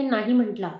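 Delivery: ordinary delivery, nothing marked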